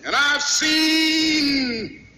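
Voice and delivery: high, ringing sermon climax; impassioned, exultant proclamation building to a shout; full-force preaching. Real, not acted. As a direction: cry it out to the roaring congregation. Sermon climax to cheering congregation.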